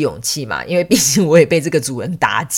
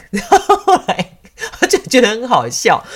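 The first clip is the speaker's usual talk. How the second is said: amused; 7 semitones higher, 6 dB louder than usual. Real, not acted.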